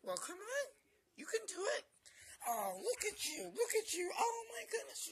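high, loud excited squealing; gushing puppy talk. Real, not acted. Owner praising puppy on camera, gushing puppy talk. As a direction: cheer the puppy on, voice way up.

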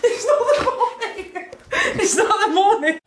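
laughing hard through the words; giggling too much to talk; hysterical laugh. Real, not acted. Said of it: "Why are you laughing so hard?".

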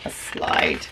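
soft whispered echo; whispering. Real, not acted. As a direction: whisper the answer back. Parent echoes child's answer.

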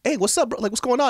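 high, upbeat hey-buddy voice; friendly greeting. Retold or imitated. Student greets his childhood best friend, high and cheery. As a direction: greet him like a long-lost pal.

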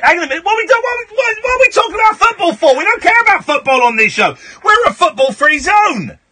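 high, fast indignant outburst; mock outrage. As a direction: cut in, squawking in exaggerated protest. Host mock-outraged at football talk.

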